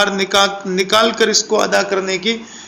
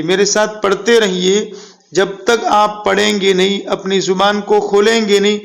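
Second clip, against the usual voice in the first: ordinary talk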